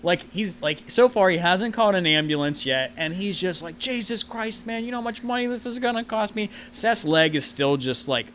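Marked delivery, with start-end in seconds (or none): 3.8-6.5 chewing him out. Acted. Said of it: "basically chewing Seth's ass out".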